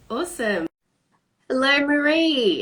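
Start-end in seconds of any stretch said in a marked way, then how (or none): 1.5-2.6 high, bright hello with slowed vowels; drawn-out cheerful welcome; excited greeting. Real, not acted. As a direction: greet her warmly and big, like a host going live. Host greets guest on live.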